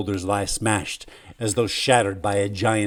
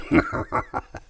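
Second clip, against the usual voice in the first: choking laugh. Acted. Dying man's laugh.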